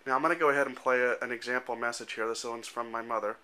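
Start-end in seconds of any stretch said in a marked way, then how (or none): none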